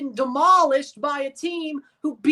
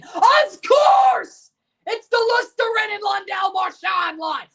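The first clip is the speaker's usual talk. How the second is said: loud, sarcastic outburst, much higher on of course; exasperated yell; 4 semitones higher, 4 dB louder than usual. Real, not acted. Fan raging at the opposing line, loud and sarcastic. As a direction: shout it in bitter disbelief. Angry at opponent's scoring line.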